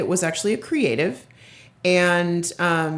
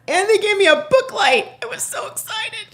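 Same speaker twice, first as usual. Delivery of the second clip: high, thrilled and gushing; bursting with excitement; excited; 12 semitones higher, 3 dB louder than usual. Real, not acted. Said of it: "I was just a little excited".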